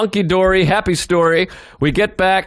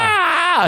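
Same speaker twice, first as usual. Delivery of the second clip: high-pitched imitated scream; panicked scream; 13 semitones higher than usual. Driver screams as teens bang on his window, freaking out. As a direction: act out a startled driver's yell. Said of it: "He starts freaking out".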